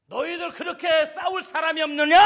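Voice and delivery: Goliath shouting down at the frightened army; giant's roar. Acted. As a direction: roar the dare at the whole army.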